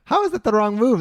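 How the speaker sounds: can't believe what he's hearing; incredulous. Real, not acted. Co-host reacting to the story's twist, loud and incredulous.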